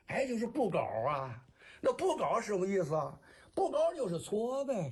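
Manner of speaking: Shandong kuaishu patter in Jinan dialect; 山东快书. Shown as a demonstration. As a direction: perform a comic storytelling rhyme in Shandong accent. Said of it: "山东快书我也会". (济南话说山东快书)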